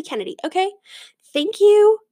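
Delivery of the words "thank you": loud, high, exaggerated thank you; big theatrical "Thank you" pitched up; mock grateful thanks. Mock thanks to Dad and Douglas.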